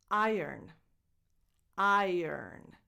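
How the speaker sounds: "iron" slowly as EYE-urn; inverted R pronunciation. Shown as a demonstration. Teacher explaining a phonetic exception, inverted R pronunciation. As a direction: say iron as if the R followed the O. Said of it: "As if the R and the O were inverted".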